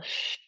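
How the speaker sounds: quiet long sh breath; soft shushing exhale. Shown as a demonstration. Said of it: "This time the liver is sh".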